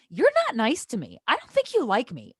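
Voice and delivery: high, loud aggrieved declaration; retold confrontation. Telling off mean best friend, high loud protest.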